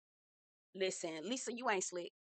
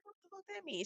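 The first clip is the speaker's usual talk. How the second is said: high, much softer hushed aside; hushed question; 9 semitones higher, 14 dB quieter, faster than usual. Retold or imitated. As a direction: ask it in a secret hush. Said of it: "I had to ask".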